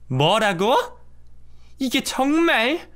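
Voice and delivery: high, drawn-out outraged mother-in-law; can't believe she's being told to apologise; indignant mother-in-law. Acted. (기가 막혀 하는 시어머니)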